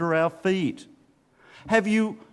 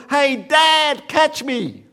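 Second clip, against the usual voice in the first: high, loud call from above; child calling out; 9 semitones higher, 7 dB louder, slower than usual. Retold or imitated. Son jumping off a rock yelling to his dad, high excited shout. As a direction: yell it out to dad as you leap.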